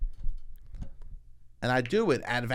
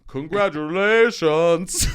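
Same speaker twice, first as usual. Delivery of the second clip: loud, higher, drawn-out congratulations; mock announcement; 6 semitones higher, 9 dB louder, much slower than usual. Comic after naming the renamed bridge, loud mock congratulations. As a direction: call it out slowly, hammed up.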